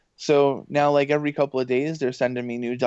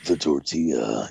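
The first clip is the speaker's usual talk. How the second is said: much lower epic movie-trailer voice in the style of Don LaFontaine; 10 semitones lower than usual. Shown as a demonstration. Host demos a much lower epic movie-trailer voice. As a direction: boom it out low like a blockbuster trailer. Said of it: "that Don LaFontaine, like, epic movie trailer".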